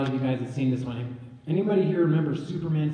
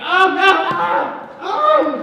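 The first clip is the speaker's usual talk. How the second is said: high, loud mock distress; panicked Superman; 11 dB louder, much slower than usual. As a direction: wail like a hammed-up hero under attack. Voices Superman being scratched.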